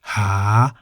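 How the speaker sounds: drawn-out "ha" sliding upward; rising tone on "ha". Shown as a demonstration. Teacher gives the word meaning to search, rising tone. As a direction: lift the end of "ha". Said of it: "in the rising tone".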